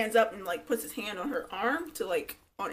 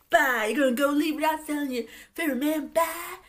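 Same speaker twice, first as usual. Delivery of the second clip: loud, high, hammed-up farewell; imitating Jesse; 6 semitones higher, 7 dB louder than usual. Jesse sees Darcy off, hammed-up imitation. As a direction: call out in a put-on mock voice.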